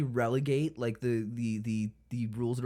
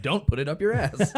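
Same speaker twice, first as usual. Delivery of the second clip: laughing; 6 semitones higher, 5 dB louder than usual. Retold or imitated. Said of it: "So one of 'em's like".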